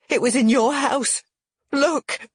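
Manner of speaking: high, loud accusation; crying out. A narrator's reading. Woman shows the damning ring, crying out. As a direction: cry out and thrust the proof forward. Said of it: "I cry".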